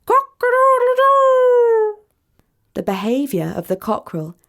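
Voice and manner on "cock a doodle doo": high, loud, drawn-out rooster crow; a cockerel's cock-a-doodle-doo, much higher and louder. A narrator's reading. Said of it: "He made his call to the hens".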